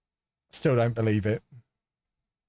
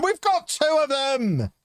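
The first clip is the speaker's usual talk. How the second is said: excited shout; 14 semitones higher, slower than usual. Real, not acted. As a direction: draw it out in triumph. Same words, now cheering goal.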